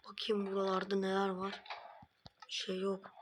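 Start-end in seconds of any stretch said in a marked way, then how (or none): none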